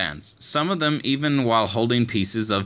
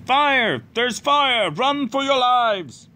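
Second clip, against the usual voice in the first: shouted alarm; 12 semitones higher, slower than usual. A narrator's reading. A neighbour calling out fire to the sleeping house. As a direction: yell the alarm down the street.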